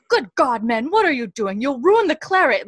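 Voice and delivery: high, loud scandalized outburst; mock outrage of an offended general; disturbed, offended general. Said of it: "disturbed, and he's like".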